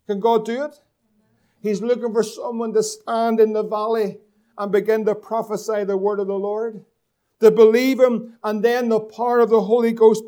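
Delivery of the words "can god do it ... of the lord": ordinary talk, nothing marked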